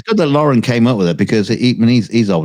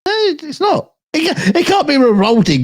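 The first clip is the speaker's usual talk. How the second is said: indignant high protest. Real, not acted. Indignant denial, high pitch.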